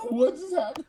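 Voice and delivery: high laughing question; giggling bewildered return. Real, not acted. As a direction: laugh it out, baffled. Player returns confused, laughing.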